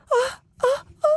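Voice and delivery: hammed-up high wailing sob; mock sobbing. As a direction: fake a dramatic cry, way up high. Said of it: "hun gråter på ekte". (overspilt, lys hulking)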